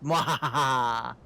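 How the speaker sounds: slow villainous cackle; hammed-up evil mwahaha; evil laugh. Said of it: "you are laughing very evilly there".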